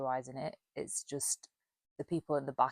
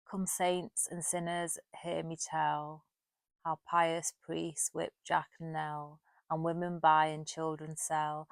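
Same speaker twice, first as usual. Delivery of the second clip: loud, slow, measured verse reading; poem recitation; 7 dB louder than usual. Acted. Vlogger reading the poem A Parody to viewers, slow declaimed recitation. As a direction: declaim the verse with weight on each line.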